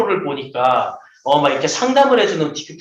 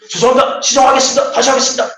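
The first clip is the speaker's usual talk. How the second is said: higher, louder, clipped military apology; crisp soldier reply; 6 semitones higher, 3 dB louder, faster than usual. Retold or imitated. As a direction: snap out the apology like a drilled private. Proper soldier answers the senior.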